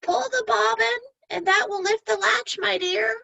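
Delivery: high, frail grandmother voice; a high quavering old lady calling out; old granny. Acted. Granny in bed answering the door, high and frail.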